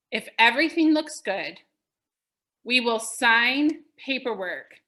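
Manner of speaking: slow, measured read for note taking; dictating slowly to the class; slow dictation. Shown as a demonstration. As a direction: say it slow so they can write it down. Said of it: "I'll say it slow so you can write it down".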